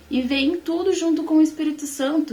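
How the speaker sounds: ordinary talk, nothing marked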